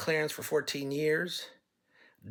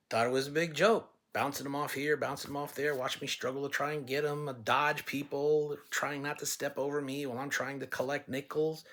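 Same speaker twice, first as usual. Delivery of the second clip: usual speaking voice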